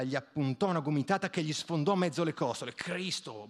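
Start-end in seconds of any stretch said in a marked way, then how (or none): 2.7-3.5 grumbled curse. A narrator's reading. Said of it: "borbottò".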